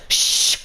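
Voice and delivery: long hushing "shh"; drawn-out mock shush; teacher's shush. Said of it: "And she goes".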